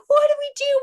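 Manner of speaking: high, loud mock-panicked wail; freaking out. Distraught friends after finding the eaten hamster, high panicked wail. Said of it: "we're freaking out".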